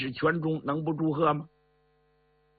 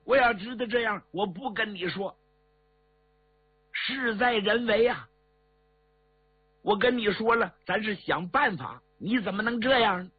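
urgent pleading; 6 semitones higher than usual. Acted. Brother restrains despairing Shengying.

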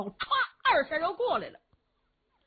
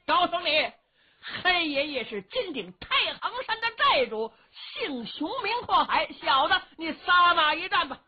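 loud, high, boastful shout; blustering challenge; 7 semitones higher, 3 dB louder than usual. Acted. Xiong Kuohai naming himself to Yuwen Chengdu, a loud blustering challenge. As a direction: roar out your name and dare him to fight.

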